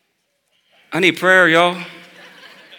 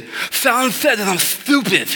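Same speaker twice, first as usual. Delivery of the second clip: put-on higher child voice, whiny tattle; tattling little girl; 9 semitones higher than usual. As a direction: play the little girl telling on him. Daughter telling on her brother.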